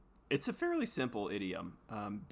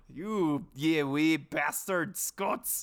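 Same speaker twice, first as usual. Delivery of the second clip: loud, higher mock-Scottish curse; slow, drawn-out insult in a put-on Scottish accent; 4 semitones higher, 7 dB louder, much slower than usual. Podcaster cursing the other Scots, in a put-on Scottish accent. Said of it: "It's funny you use that accent".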